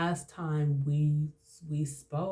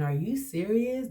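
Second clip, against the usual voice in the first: higher-pitched indignant question; incredulous; 6 semitones higher than usual. Retold or imitated. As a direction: retell a challenge in disbelief. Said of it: "when I started saying".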